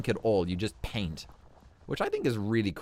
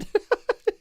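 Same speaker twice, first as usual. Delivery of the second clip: high squeal of laughter; 8 dB louder than usual. Real, not acted. Laughing at the dropped sheep.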